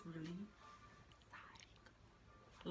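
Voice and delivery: breathy hushed psycho; whispered aside. Real, not acted. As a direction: whisper it like a secret. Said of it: "whispered aside".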